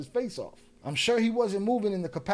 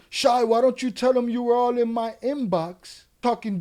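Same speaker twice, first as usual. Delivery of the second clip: louder, higher imitation of a commenter; retold loud challenge; 5 semitones higher, 6 dB louder than usual. He goes back at Shy in the comments, loud retort. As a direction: push out his challenge, raised voice. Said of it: "goes back to the comments and says".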